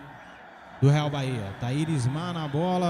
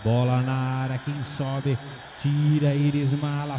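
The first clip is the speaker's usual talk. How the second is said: ordinary talk, nothing marked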